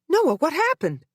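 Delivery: high, panicked cry of a name; startled mother calling out; alarmed. A narrator's reading. Mother seeing her injured son, alarmed and high.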